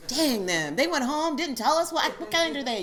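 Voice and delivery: loud, high, indignant complaint; hammed-up grumble at the friends who left; mock outrage.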